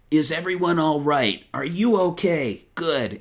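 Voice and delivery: mock a stock heroic line read, drawn out; imitating Jason's Sonic. Host mimicking the older Sonic actor's lines, put-on hero voice. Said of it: "going back to the Jason one, it's just like".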